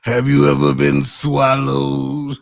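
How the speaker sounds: loud, drawn-out preacher-style question; T D Jakes. Acted. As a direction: stretch it out loud in a mock-solemn impersonation. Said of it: "You T D Jakes".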